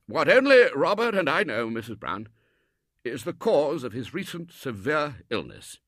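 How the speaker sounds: high and loud, slowing at the end; solemn Colonel. Acted. Colonel telling Mrs Brown a secret, loud and solemn. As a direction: announce a grave secret.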